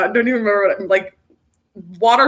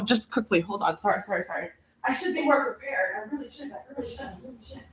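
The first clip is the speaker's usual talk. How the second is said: quieter as she moves off; fading away; 11 dB quieter, faster than usual. Real, not acted. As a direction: trail off while stepping away. Turns away looking for item.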